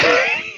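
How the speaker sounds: high burst of disbelieving laughter; incredulous laugh. Real, not acted. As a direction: laugh in disbelief at the absurd question. Incredulous at the question.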